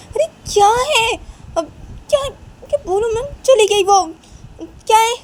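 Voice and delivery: very high, loud squeal of protest; hammed-up shrill annoyance; exasperated outburst. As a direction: scold the laughing family, high and loud. Sister exasperated as family laughs.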